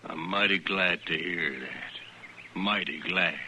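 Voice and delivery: slow, low, mocking drawl; sarcastic taunt. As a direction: savour the threat slowly and low. Rancher taunting the sheepman.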